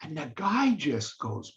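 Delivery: normal talking voice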